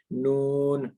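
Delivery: नून held long with rounded lips; rounded articulation. Shown as a demonstration. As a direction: round the mouth on नून and sustain it. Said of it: "मुंह को क्या करना है, गोल करना है".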